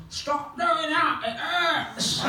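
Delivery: very high, drawn-out put-on whine; mock pained complaint. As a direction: imitate a revived man protesting in pain. Revived Ricky complains of pain.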